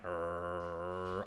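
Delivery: low, quiet rumbling growl; soft, low "rrrr" of a warning dog; dog's growl. Retold or imitated. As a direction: imitate a small dog growling steadily. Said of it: "And he's like".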